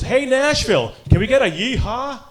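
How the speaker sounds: high, rousing arena announcer; PA announcer imitation. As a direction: work the crowd like a hockey announcer. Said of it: "the P A announcer goes".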